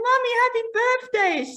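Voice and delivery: put-on high, sweet toddler voice; little girl voice. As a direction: chirp it like a three-year-old. Three-year-old daughter voiced.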